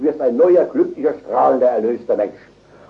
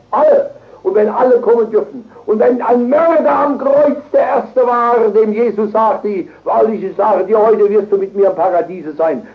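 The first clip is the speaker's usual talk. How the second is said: raised, driving proclamation; full-force preaching; 8 semitones higher, 5 dB louder than usual. Real, not acted. Preacher argues that even a murderer may come, at full force. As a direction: build it in a high, urgent stream.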